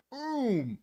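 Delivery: usual speaking voice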